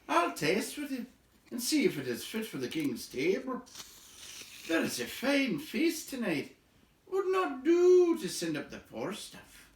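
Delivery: high, jolly guard voice; high-pitched merry guard; merry guard chief. Acted. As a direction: play a cheerful elf guard boasting of the feast. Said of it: "laughed the chief of the guards".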